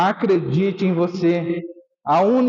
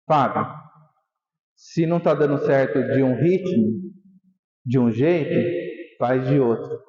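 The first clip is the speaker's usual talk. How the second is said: much lower, gentle coordinator's voice; calm low reassurance; 7 semitones lower than usual. Retold or imitated. Coordenador fala baixinho no ombro. (voz baixa e tranquilizadora do coordenador)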